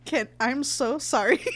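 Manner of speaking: half laughing, half crying sorry; laugh-crying apology. Retold or imitated. Patient apologizing to the nurses, laugh-crying. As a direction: wobble between a laugh and a sob. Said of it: "now I'm sad".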